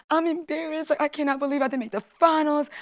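high, upset voice; replaying the tears from the breezeway; tearful retelling. Retold crying after defeat.

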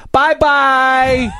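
put-on high, loud singsong kid voice; child's bye-bye. Little daughter waving to the old people, sweet singsong. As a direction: chirp it like a little girl.

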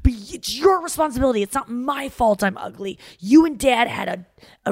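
put-on high-pitched, whiny child pleading; whiny kid. Daughter blaming her mom for her looks, whiny child voice. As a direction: whine like a teenager blaming her parents. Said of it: "I'd just be like".

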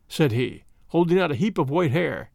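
ordinary delivery, nothing marked

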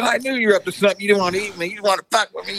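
high-pitched mock accusation, kept light in volume; imitated angry rant. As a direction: rant like the indignant prank victim. Retold rant of pranked friend.

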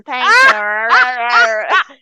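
put-on English with an American accent. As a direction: say it like an American kid. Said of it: "tudo com um sotaque americano".

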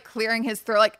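ordinary talk

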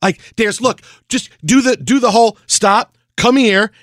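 animated. Retold or imitated. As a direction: get fired up arguing the play. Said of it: "he's getting really animated".

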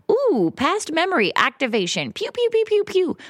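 high zapping sci-fi voice with pew pew sounds; mock brain alarm. Describing her brain triggering, she voices playful laser zaps. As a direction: play your brain as a silly laser gadget. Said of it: "My brain is activated, like".

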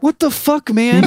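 high, loud mock outburst; squeaky incredulous lawyer impression; exasperated lawyer. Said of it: "his lawyer's like".

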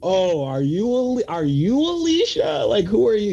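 high, slow, unsure mock voice; awkward mock question. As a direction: play a confused fan fumbling the name. Mock awkward question to Alicia lookalike.

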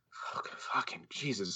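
slow, hushed cursing under the breath; retold exasperation, barely voiced; exasperated whisper. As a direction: whisper it slowly in mock exasperation. Retelling private exasperation at producer.

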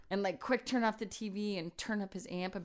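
imitating a guitar riff with her mouth, laughing; mock guitar noise. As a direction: blurt out a silly guitar riff sound. Hiding from girlfriend, fake guitar playing.

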